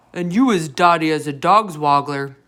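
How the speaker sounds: loud gruff brute jeering; brute giant cry. A narrator's reading. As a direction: jeer it like a brute. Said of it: "cried the blood bottler".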